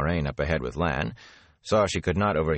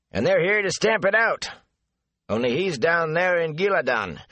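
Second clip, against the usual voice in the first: higher, folksy gossiping gatekeeper; a garrulous country man passing on rumours; chatty rustic gatekeeper; 11 semitones higher than usual. Acted. Gossiping gatekeeper Avin talks.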